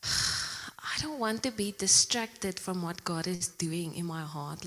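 ordinary talk, nothing marked

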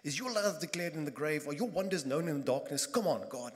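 loud, challenging jab at God; angry defiance. Retold or imitated. Psalmist taunting God from the grave, loud and defiant. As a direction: throw it back at God in disgust. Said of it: "he's angry at God and disrespecting".

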